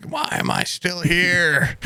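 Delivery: high, drawn-out mock lament; lamenting grandma. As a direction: wail slowly as a fed-up old grandma. Imitating grandmother tired of living.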